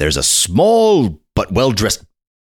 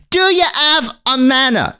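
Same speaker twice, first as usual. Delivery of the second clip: put-on much higher, prim boy's voice; snooty little boy. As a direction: look down your nose like a posh little boy. Boy repeating his question.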